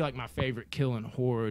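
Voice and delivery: ordinary talk, nothing marked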